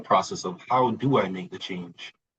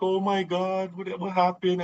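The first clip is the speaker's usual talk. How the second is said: mock sympathetic gasp; 11 semitones higher than usual. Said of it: "And everybody's like".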